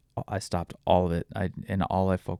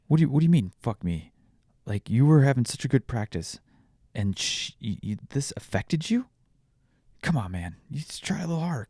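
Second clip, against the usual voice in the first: retold pushy dad; 5 semitones higher than usual. Dad needles his upset child in the car, mildly pushy. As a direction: voice jumping higher in mild disbelief. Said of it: "he just was fucking pushing it".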